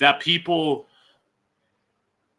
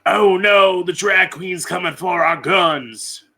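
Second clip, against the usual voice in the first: mocking gun owner; 5 semitones higher than usual.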